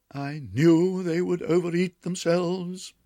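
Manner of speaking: gossiping cousin. A narrator's reading. Tabitha Twitchit gossiping about the feast, smug. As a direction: say it smugly. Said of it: "said cousin Tabitha Twitchit".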